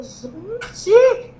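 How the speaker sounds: high, stretched gushing cry; re-enacted squeal of amazement; retold excited yelling. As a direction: gush in a high voice, then rattle off. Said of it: "Comecei a falar alto, a gritar".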